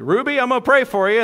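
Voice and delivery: bold, loud and high announcement; barging in, loudly declaring a prayer. Retold or imitated. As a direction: boom it out like he owns the room. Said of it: "says very loudly".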